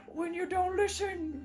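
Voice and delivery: mock spooky scolding; ominous voice. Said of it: "talk in an ominous voice".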